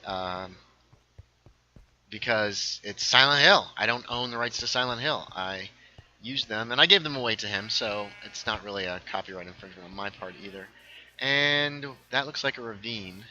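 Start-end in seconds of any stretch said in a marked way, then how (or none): none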